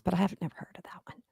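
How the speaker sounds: fast whispered confession. Real, not acted. Friend whispering that she never heard of the show. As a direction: say it as a quick hushed aside. Aside confessing she's out of loop.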